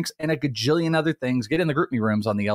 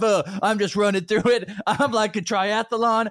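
higher, smug know-it-all voice; mock bragging impression; 9 semitones higher than usual. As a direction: ham up a cocky sick friend bragging. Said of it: "You go".

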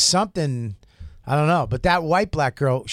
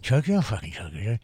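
choking voice; 4 semitones lower than usual. Retold or imitated. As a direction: squeeze the words out like being choked. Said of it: "that choking voice you get".

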